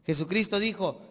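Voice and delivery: ordinary talk, nothing marked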